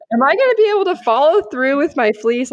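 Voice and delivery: fast, high, loud retold panic; freaking out. Woman asking God about her fleece, freaking out. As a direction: blurt the worried question in mock panic. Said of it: "I was freaking out".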